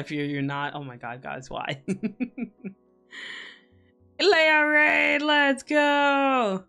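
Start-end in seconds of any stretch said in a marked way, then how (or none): 4.2-6.6 high, loud hype; hype call. Real, not acted. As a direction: cheer it like an announcer. Hyping the incoming raid.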